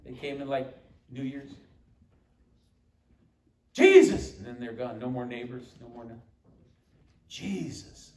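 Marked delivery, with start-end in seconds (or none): 3.7-4.4 a burst of a cry, much higher and louder; sudden exclamation. Real, not acted. Preacher to congregation, a sudden loud high cry of Jesus. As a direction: blurt the name out like a sudden cry.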